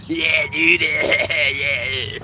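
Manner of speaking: lightly voiced raspy Steve-O; a hint of Steve-O's stoner drawl; Steve-O impression. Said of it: "like Steve-O".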